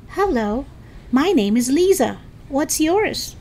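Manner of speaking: high, sociable greeting; friendly. A narrator's reading. Lisa holds out her hand to driver, friendly. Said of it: "didn't sound quite as friendly as before".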